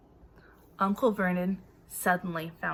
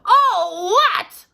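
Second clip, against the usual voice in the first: loud, much higher thunderous echo; thundered; 11 semitones higher, 9 dB louder than usual. A narrator's reading. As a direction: roar the words back in disbelief. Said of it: "Hagrid thundered".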